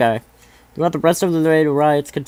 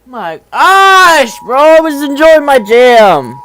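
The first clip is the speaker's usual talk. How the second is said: annoyed outburst; 5 semitones higher, 11 dB louder, much slower than usual. Real, not acted. Ride interrupted.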